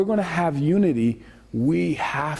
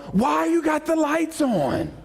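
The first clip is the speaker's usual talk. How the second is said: mock annoyed; 9 semitones higher, slower than usual. Complaining about lights left on.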